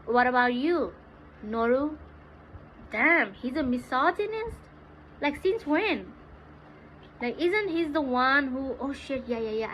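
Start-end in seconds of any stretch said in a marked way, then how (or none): none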